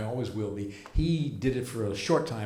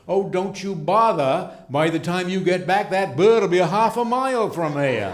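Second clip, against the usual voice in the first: loud, high hammed-up old captain's voice; old ferry captain; 7 semitones higher, 8 dB louder than usual. Captain dismissing the matron's offer, loud hammed-up old skipper voice. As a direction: boom it out like a salty captain.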